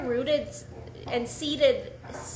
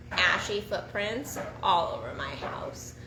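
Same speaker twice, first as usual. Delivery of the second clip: ordinary delivery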